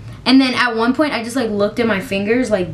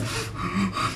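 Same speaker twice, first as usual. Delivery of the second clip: sharp gasp; 3 semitones lower, 11 dB quieter than usual. Retold or imitated. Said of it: "I was just like".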